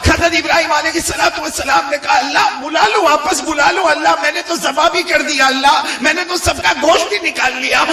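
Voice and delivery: high, driving sermon delivery; high-pitched fervent retelling; impassioned preaching. Acted.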